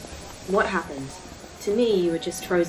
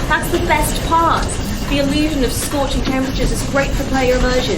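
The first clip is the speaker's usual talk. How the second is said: higher, louder, keen tour guide; shouting over noise; 6 semitones higher, 7 dB louder than usual. Acted. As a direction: proudly shout over the engine. Shouting in the hot engine room.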